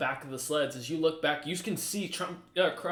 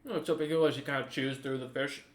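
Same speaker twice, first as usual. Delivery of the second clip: ordinary talk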